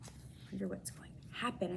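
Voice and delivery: hushed whisper. A narrator's reading. Hamster whispers, chilled by the mom's words at bedtime. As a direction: hush it like a spooky secret.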